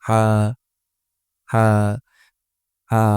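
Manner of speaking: each হা pushed from mid-throat, separately; throat-pressed হা. Shown as a demonstration. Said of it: "pressure দিয়ে উচ্চারণ করতে হবে". (কণ্ঠনালীতে চাপ দিয়ে হা)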